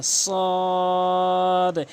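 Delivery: ordinary talk, nothing marked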